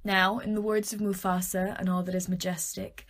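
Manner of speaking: ordinary talk, nothing marked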